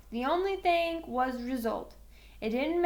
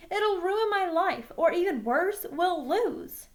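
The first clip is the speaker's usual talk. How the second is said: higher, louder, pleading panic; desperate protest; 7 semitones higher, 4 dB louder than usual. Acted. Boy begging coach to keep him on team, higher and louder. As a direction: beg as if life is ending.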